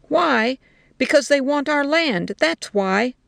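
indignant soldier's comeback; heated retort. A narrator's reading. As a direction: throw the question back and answer it forcefully. Soldier retorts to her question.

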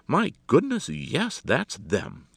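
loud, high burst, then settling; surprised exclamation that starts way up; excited cry. A narrator's reading. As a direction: cry out in sudden recognition. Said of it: "cried Alexander".

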